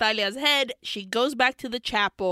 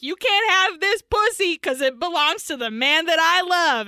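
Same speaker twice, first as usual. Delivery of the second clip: loud, raised theatrical declaration; defiant proclamation; 6 semitones higher, 6 dB louder than usual. Acted. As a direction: belt it out as a hammed-up refusal. Acts heroine defying the devil.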